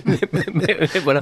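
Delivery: words through a laugh; giggling while getting words out. Real, not acted. Host laughs at guest's teasing. (en riant)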